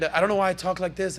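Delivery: ordinary talk, nothing marked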